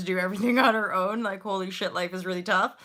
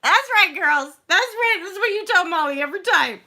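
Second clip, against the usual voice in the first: much higher, louder, gleeful; laughing, smug "that's right"; smug approval; 11 semitones higher, 9 dB louder than usual. Retold or imitated. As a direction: accept the flattery proudly. Proudly accepting flattery.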